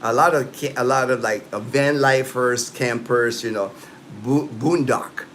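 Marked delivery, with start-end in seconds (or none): 4.5-5.2 boondock as in the US; American pronunciation. Shown as a demonstration. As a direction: boondock with a long oo and an open dock. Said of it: "It's pronounced boondock in".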